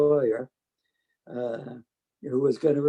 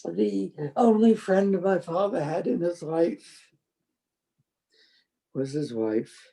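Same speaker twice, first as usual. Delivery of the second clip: tearful; 3 semitones higher than usual. Real, not acted. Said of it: "I'm gonna cry over this".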